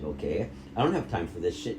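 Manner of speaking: normal talking voice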